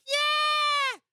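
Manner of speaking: very high, sustained mock cheer; long high-pitched holler; crowd's cheer. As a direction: imitate a whole crowd shouting back. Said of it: "And everyone's like".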